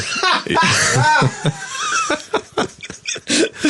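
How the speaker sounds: long high-pitched cackle; high cackling laugh. Real, not acted.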